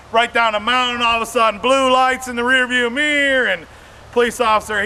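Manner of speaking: ordinary talk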